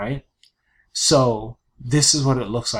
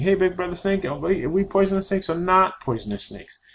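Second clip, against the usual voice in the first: lightly voiced little snake, pitched up; little brother snake; 7 semitones higher than usual. Little brother snake pestering big brother, lightly voiced. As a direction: ask it again like an eager kid snake.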